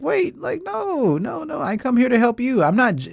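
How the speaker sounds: high, flustered objection, pitch jumped way up; defensive protest. Retold or imitated. Retelling a friend's criticism, voicing a flustered high protest. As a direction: protest that you only came to help.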